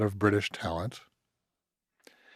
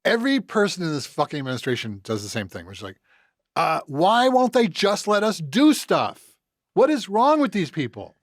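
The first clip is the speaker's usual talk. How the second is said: mock whiny complaint; 7 semitones higher than usual. Mocking the administration's whining about mergers, higher and whiny. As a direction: whine like a spoiled official.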